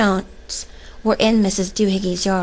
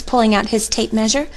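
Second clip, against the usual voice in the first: ordinary delivery, nothing marked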